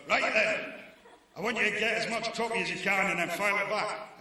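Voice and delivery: full-volume high-pitched shout; shouting back. Retold or imitated. News editor shouting instructions back into the CB radio, loud shout. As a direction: bellow it into the radio at the reporter. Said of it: "he would shout back into".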